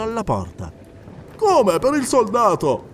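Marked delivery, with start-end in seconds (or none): 1.3-2.8 higher, louder bewildered question; puzzled question. Acted. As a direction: soldier asking, baffled.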